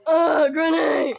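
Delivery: loud, drawn-out cry of exasperation; slow, wailing shout at Granny; frustrated wail. Real, not acted. Frustrated cry at game enemy.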